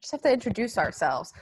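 usual speaking voice

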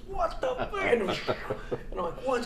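high, laughing disbelief; baffled laugh. Retold or imitated. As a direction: squeak out your confusion through a laugh.

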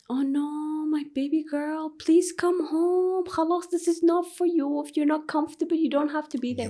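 high, put-on doting mom voice; coddling mother. Mother imagining coddling her scared daughter, high doting voice. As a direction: play the overprotective mother, babying her. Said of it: "But if I told you".

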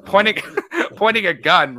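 laughing through the word; giggling, high and loud. Real, not acted. Laughing at own joke.

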